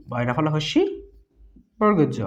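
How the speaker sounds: ordinary talk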